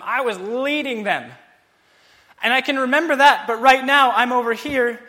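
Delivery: high, loud, aching memory; anguished lament. Acted. Preacher voices the psalmist's lament, loud and aching. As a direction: plead as a leader lost in exile.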